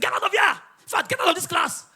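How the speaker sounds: high, shouted dismissal; angry teacher. Acted. Tired teacher sends laughing pupil out, shouting. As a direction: throw the pupil out with a yell.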